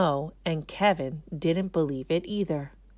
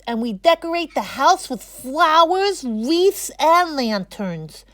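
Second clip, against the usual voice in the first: put-on excited kid presenter; 8 semitones higher, 8 dB louder than usual. A narrator's reading. Moises tells the class about Christmas, excited. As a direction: tell your class about Las Posadas with glee.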